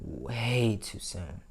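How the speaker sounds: slow, quiet, hiccuping repeat; hiccups. Real, not acted. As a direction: speak softly through the hiccups. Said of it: "I got the hiccups now".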